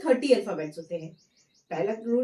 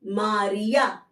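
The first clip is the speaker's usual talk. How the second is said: slow model word; 2 semitones lower, much slower than usual. Shown as a demonstration. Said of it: "एमे".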